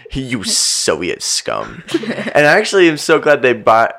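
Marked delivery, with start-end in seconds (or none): 0.1-1.8 slow, low, quieter mock sneer; paranoid Murray sneer. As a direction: drag it out in disgust. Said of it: "He's like".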